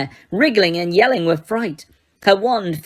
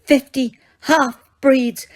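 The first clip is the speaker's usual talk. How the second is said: much higher, slow, hysterical Umbridge; screamed; 6 semitones higher, much slower than usual. A narrator's reading. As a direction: shriek the insult in terror. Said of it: "she screamed".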